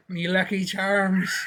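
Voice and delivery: slow, laughing Irish brogue; stage Irish. Retold or imitated. S0 repeats his Irish joke to his friend in a slow Irish brogue. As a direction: drag it out like a leprechaun. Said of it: "He turns Irish".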